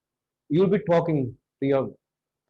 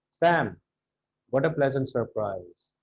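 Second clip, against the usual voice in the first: low, unexcited greeting with no amazement; unsurprised; 5 semitones lower, 3 dB quieter than usual. Shown as a demonstration. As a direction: say it without any surprise, letting it sink. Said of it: "You can't sound like this".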